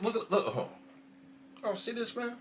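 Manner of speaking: ordinary talk, nothing marked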